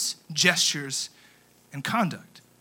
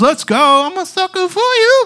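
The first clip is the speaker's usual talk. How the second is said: hyped fan; 13 semitones higher, 9 dB louder than usual. Pretend. Hyped fan at Jonas Brothers.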